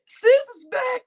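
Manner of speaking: high squeal of welcome; excited greeting. Real, not acted. Streamer greeting sis arriving, high excited squeal. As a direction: call out to sis with a delighted squeal.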